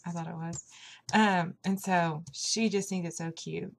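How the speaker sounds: usual speaking voice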